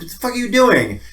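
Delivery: can't believe what they're doing; incredulous. Retold or imitated. Mock disbelief at ethicists who invented a word.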